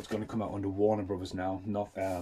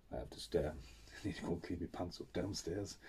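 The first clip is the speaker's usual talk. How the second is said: much softer, awestruck; stunned fan, softer, half laughing; hushed awe; 4 semitones lower, 13 dB quieter than usual. Retold or imitated. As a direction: breathe it out, overwhelmed.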